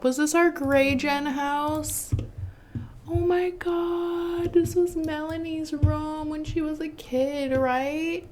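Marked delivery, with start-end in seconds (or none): 3.1-8.3 high, slow, hushed in wonder; nostalgic awe. Real, not acted. Gamer recognizing a beloved old room, awed. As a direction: breathe it out with fond awe.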